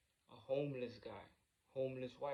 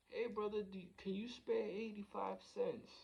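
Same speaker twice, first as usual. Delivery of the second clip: high-pitched stranger asking a favour; imitated polite plea; 8 semitones higher than usual. Homeless man asks driver for spare change, high imitated plea.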